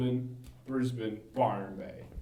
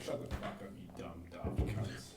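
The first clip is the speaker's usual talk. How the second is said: muttered retort; 7 semitones lower, 12 dB quieter than usual. Retold or imitated. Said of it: "And I'm like".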